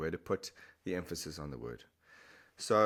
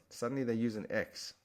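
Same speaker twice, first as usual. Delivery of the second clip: ordinary talk, nothing marked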